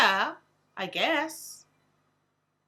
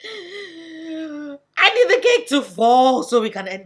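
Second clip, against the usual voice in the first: desperate plea; 3 semitones higher, 10 dB louder, slower than usual. Retold or imitated.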